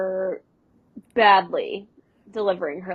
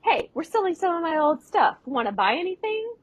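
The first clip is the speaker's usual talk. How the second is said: higher eager little-brother pitch; Matt salesman; 8 semitones higher than usual. Retold or imitated. Matt pitching old stuff to Gordo, higher eager voice. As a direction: sell it eagerly. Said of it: "and he says".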